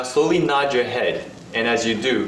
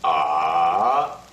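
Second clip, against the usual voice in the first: "ah" dipping low then rising; falling-rising "ah"; third tone; 6 semitones lower than usual. Shown as a demonstration. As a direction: dip "ah" down and bring it up. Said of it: "The third tone".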